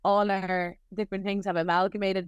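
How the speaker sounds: usual speaking voice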